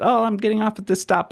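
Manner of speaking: put-on, high-pitched little announcement; feigned casual excuse. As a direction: lightly act out a polite excuse. Acts out feigned excuse to leave.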